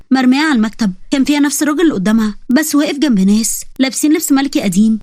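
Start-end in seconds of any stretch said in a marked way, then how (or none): none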